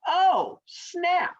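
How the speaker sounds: high, drawn-out sassy reaction; mock indignant turtle. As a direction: rise high in playful offense.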